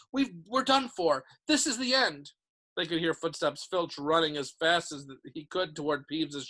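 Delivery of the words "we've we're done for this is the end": high despairing wail, mild; moaning. A narrator's reading. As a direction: lament that all hope is gone. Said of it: "Ron moaned".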